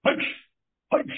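short throat-clearing huffs; two short, separated throaty bursts; imitated wrestler's bark. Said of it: "when Brody would come out".

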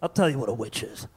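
low, soft boozy mayor; boozy old mayor about to hold forth; drunk mayor. Acted. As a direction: answer deep and quiet, like a tipsy official. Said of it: "he's always drunk".

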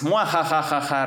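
mock villain cackle; hammed-up evil laugh. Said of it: "risa maligna".

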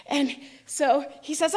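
ordinary delivery

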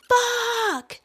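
high yelled curse into the night; imitated scream. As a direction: belt it out like the guy on the block. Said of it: "just screaming".

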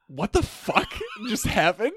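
high startled yelp with laughter; startled laughing. Retold or imitated. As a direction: laugh through a startled cry. Startled man retold, laughing.

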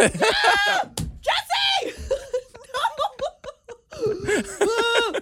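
real high, startled yelps breaking into laughter; horrified laughing screams; shocked shriek. Real, not acted. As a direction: shriek his name in disgusted shock, laughing. Shocked by gross photo reveal.

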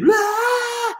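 put-on high screeching wail like a toddler; kid tantrum scream. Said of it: "They're screaming".

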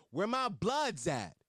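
slow, drawn-out crowd call; mock yell. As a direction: stretch it like a hype man. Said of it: "and yelled".

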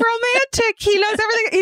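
high-pitched pretend swoon; mock gushing. Co-host voicing her romantic first reaction, mock gushing. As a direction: gush it way up high, playfully.

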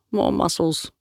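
a hint of sneer tossed off; mocking thug. Tattooed thug mocking Liam's build, understated sneer. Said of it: "said Snake Tattoo".

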